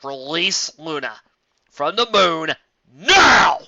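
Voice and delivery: loud, slow shouted order; shouted demand. Acted. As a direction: bark the order at her. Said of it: "the guard interrupted".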